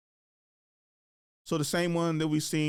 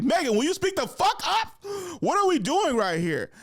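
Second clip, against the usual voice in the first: mock yell; 6 dB louder than usual. Yelling at the actress on screen.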